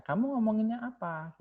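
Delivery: pitched well up, asking; high asking voice. Shown as a demonstration. Teacher acting out a student's question, pitched high. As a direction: ask it up high, like a real question. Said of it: "gitu kan".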